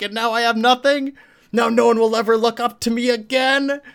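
high, loud raging screams; furious yell. Retold or imitated. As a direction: scream it like a betrayed superhero losing control. Plutonian raging at the scientist.

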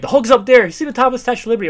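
high, loud, rushed shout; hammed-up shouting bystander; mock yell. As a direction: yell it up at the statue, fast. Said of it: "one guy's yelling".

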